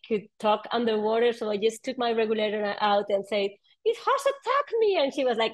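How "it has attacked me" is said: high, alarmed telling; mock frightened report, pitched way up; panicked. Telling friend about attack.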